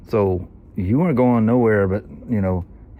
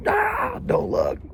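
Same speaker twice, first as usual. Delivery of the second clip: a small, mimicked cry of dread; mock alarm; much slower than usual. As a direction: recoil as if watching a near crash. Acts out watching a near crash.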